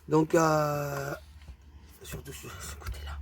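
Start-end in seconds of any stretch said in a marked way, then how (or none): 2.0-3.2 hushed whisper, breath only. Real, not acted. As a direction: lean in and whisper it in awe. Admiring paper up close. (chuchoté, admiratif)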